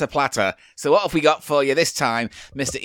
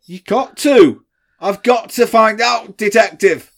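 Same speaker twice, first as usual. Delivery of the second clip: determined; 7 semitones higher, 6 dB louder, slower than usual. Pretend. Mock detective resolve.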